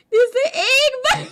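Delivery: high, loud, laughing retelling; crying laughing. Real, not acted. Streamer retelling friend's Gudetama joke, crying with laughter. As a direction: push words out through tears of laughter.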